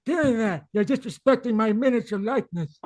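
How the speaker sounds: character voice. Acted. Acting a line in character.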